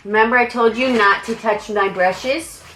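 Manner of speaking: normal talking voice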